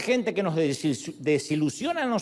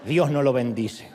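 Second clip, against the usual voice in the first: ordinary talk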